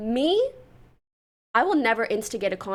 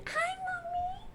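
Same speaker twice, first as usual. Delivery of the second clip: much higher, slow, cutesy "Hi, Mommy"; baby voice; 9 dB quieter, slower than usual. Pretend. Vlogger voicing her cat greeting her, in a high cutesy baby voice. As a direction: coo like a kitten greeting its owner.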